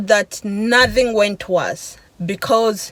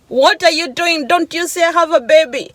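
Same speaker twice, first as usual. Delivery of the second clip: higher-pitched imitated yell; retold yelling; 7 semitones higher than usual. Mother yelling at the driver from her window, retold yell. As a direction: imitate her yelling, raised but not full force. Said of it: "yelling at me".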